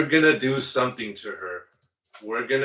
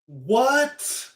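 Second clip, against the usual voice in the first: ordinary delivery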